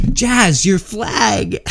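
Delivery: high, loud call to a friend; calling out to Jazz. Retold or imitated. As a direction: yell a warning at your buddy. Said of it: "and I'm just like".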